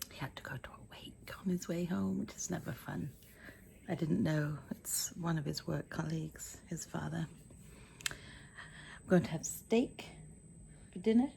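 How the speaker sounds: hushed aside about a wake; whispering. Real, not acted. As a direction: whisper it gently.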